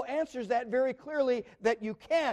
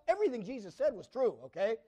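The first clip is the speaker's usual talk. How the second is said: ordinary talk, nothing marked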